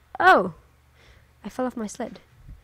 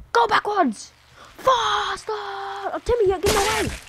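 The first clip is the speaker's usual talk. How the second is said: high excited shrieks; shrieking jackpot winner; 11 semitones higher than usual. Real, not acted. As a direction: shriek at the screen in glee. Urging game character on.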